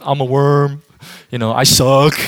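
loud, hammed-up caricature of humility; mock self-pity. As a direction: play the grovelling worm, mockingly. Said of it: "We think humble is like".

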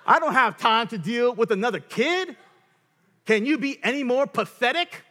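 high, loud scornful outburst; contemptuous yelling. Acted. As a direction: berate your spouse at full voice. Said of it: "That's, like, so real".

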